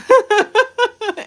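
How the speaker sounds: high burst of real laughter; loud laugh. Real, not acted. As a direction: crack up loudly. Big laugh at a comment.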